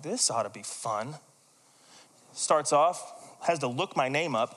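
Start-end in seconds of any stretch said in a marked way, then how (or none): 0.0-1.2 low, soft, dry sarcasm; called to the big boss's office, muttering sarcastically; sarcastic dread. Retold or imitated. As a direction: brace for trouble with a wry aside. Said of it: "and I'm like".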